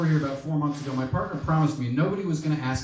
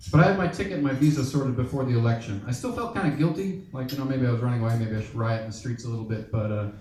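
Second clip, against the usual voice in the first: ordinary delivery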